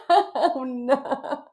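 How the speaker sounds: high laughing oh no, loud; embarrassed laugh. Real, not acted. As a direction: laugh at yourself, caught out. Embarrassed at repeating herself.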